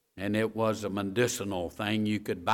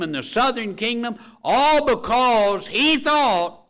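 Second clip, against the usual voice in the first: full-force preaching; 8 semitones higher, 7 dB louder than usual. Acted. Preacher to congregation, preaching at full force. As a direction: drive the point home to the pews.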